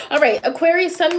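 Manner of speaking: usual speaking voice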